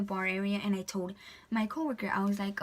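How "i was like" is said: ordinary talk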